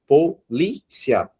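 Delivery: "Polícia" very slowly as po, lí, cia; separated syllables. Shown as a demonstration. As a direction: say "Polícia" one syllable at a time, pausing between each. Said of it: "Vocês vão separar a palavra em sílabas".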